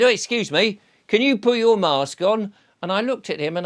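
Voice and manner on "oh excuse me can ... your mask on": mock shout from the till. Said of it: "he shouted out to me".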